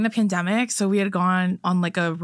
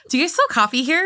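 dumb customer voice; 6 semitones higher than usual. Retold or imitated. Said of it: "when they're like".

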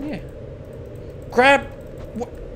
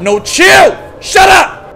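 loud, high yell to make it stop; real shout; 11 semitones higher, 11 dB louder, much slower than usual. Real, not acted. Player fed up with the game noises, shouting loud.

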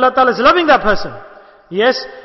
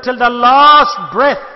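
drawn out slowly and louder; slow, weighty, louder proclamation; emphatic quote; 6 dB louder, much slower than usual. Retold or imitated. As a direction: lean hard on each word of the Prophet's words. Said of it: "The Holy Prophet said".

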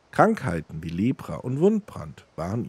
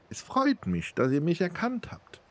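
much higher, put-on female voice; woman's voice; 10 semitones higher than usual. A narrator's reading. Namira thanks Almos for recognising her, in a high woman's voice. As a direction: pitch it right up for the beggar princess. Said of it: "Das ist ja eine Frauenstimme".

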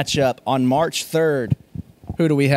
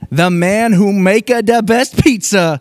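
raised, hammed-up Italian announcer voice; mock Italian accent; 4 semitones higher, 4 dB louder than usual. Announcer introducing the pizza wrestler, mock Italian accent.

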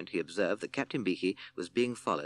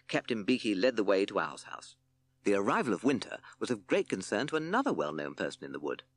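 plain reading voice

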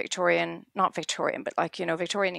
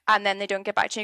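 ordinary talk, nothing marked

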